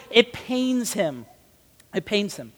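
slow, soft, sinking delivery; hushed solemnity. Real, not acted. Preacher on Jesus grieving over sins, hushed and solemn. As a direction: drop to a grave, quiet repeat.

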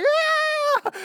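shrieked high, thrilled yeah; excited Moses. Retold or imitated. Moses thrilled at the stick trick, high shriek. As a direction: squeal it like a kid seeing magic. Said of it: "He's like".

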